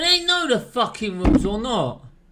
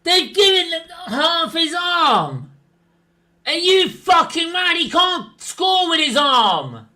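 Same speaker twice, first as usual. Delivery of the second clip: angry shout; 7 semitones higher than usual. Real, not acted. Shouting at screen.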